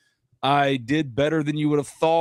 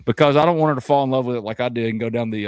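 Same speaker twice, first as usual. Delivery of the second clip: normal talking voice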